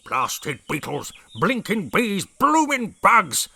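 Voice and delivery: building from gruff to a high, loud, slow bellow; ranting. A narrator's reading. Brig ranting at termites.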